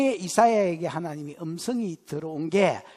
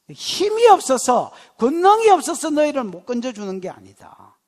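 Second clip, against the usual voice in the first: 힘주어 선포; 3 semitones higher, 7 dB louder than usual. Acted. 설교 절정에서 강조.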